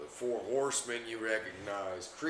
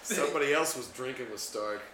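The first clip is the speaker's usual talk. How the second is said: normal talking voice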